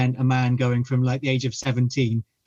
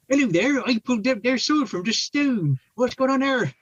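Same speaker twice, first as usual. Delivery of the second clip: higher, playful Bristolian Arthur; Bristolian accent; 10 semitones higher than usual. Retold or imitated. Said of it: "for Bristolian Arthur".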